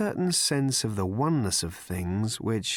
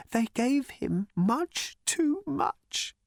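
high, slow, tearful wail; crying; much slower than usual. Acted. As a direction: break down into tears. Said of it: "He started to cry".

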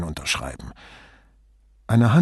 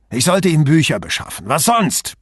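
louder, higher, exasperated outburst; angry, forced out; 5 semitones higher, 5 dB louder than usual. A narrator's reading. Sartor rounds on Brunetti, furious, forcing the answer out. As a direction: force the words out in anger, pitch jumping up. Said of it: "presste er hervor".

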